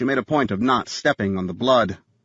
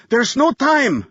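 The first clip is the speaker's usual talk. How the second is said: high, loud frantic cry; desperate yell; 13 semitones higher, 4 dB louder, slower than usual. A narrator's reading. Visitor shouting through the door, frantic yell. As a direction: beg them to hurry. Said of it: "the visitor yelled".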